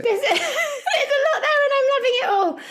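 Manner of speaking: high-pitched, delighted laughing reply. Real, not acted. Host to co-host about a listener's message, laughing. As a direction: talk through a laugh, voice lifted high.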